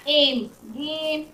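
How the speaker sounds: slow एम, गेम with a long "ay"; lengthened vowel. Shown as a demonstration. Teacher showing the long A sound, lengthened vowel in एम, गेम. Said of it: "थोड़ा लंबा हो जा रहा है".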